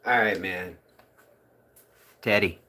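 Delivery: ordinary talk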